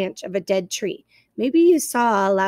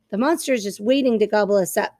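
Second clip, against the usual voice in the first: plain reading voice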